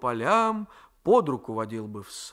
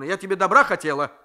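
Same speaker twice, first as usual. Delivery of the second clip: fast, louder, hurt annoyance; irritated outburst; 5 semitones higher, 10 dB louder, faster than usual. A narrator's reading. Grandmother insisting she meant well, irritated. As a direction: push it out quickly, stung. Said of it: "вспыльчиво перебила Татьяна Марковна".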